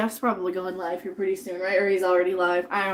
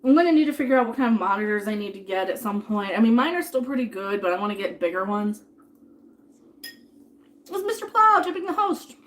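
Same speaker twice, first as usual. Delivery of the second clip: normal talking voice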